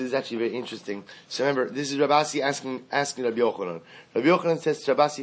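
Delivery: normal talking voice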